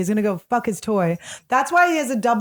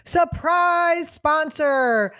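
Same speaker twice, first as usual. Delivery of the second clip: high, very drawn-out announcer call; segment announcement; 6 semitones higher, much slower than usual. Pretend. Host announcing a favourite segment, stretched-out showy call. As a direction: sing out each word slowly, pitched up.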